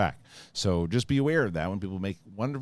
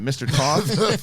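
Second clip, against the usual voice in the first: drawn-out mock name; 7 semitones higher, 8 dB louder, much slower than usual. Drawn-out jokey name call.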